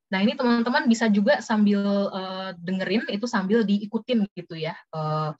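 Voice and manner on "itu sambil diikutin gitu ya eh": ordinary delivery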